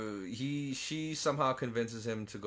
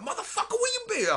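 much higher squeal; high shrieking creature voice; squeaky dinosaur voice; 5 dB louder than usual. Retold or imitated. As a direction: screech it like an angry raptor. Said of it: "he's like".

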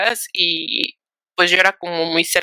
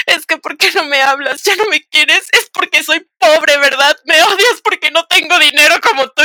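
put-on drunk whining friend; 8 semitones higher, 5 dB louder than usual. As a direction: whine it like a tearful drunk friend. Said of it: "me marca toda borracha y me dice".

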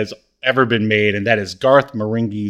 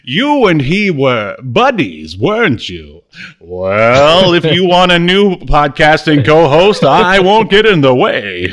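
louder, hammed-up wounded co-host; mock jealousy; 4 semitones higher, 7 dB louder than usual. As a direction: play the jilted partner, over the top.

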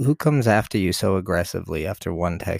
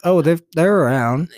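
mock shady seller; 8 semitones higher, 11 dB louder than usual. Co-host voices pretend goat sellers.

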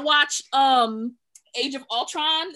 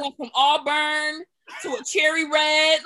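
mock wail; 4 dB louder than usual. Real, not acted. Roasting the changing wigs, loud wail. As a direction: wail it like a tragedy. Mock-wailing about wig colors.

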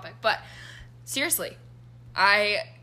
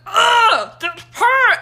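loud, much higher angry yelp; angry pain yelp; 13 semitones higher, 14 dB louder, much slower than usual. Retold or imitated. After stubbing a toe, a loud high furious yelp. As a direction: snap at the pain like it wronged you. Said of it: "I get so mad".

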